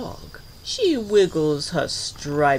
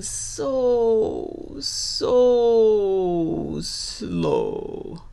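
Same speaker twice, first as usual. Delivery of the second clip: long, dragging vowels on each word; drawn-out slowness; 7 semitones higher, much slower than usual. A narrator's reading. Narrator describes an old gator's crawl, drawn out. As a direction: stretch "so, so slow" way out. Narrator draws out how slow he moves.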